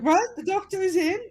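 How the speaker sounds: high questioning voice, rising at the end; child seeking confirmation. Acted. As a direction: check hopefully with your playmate. Child checks with sister.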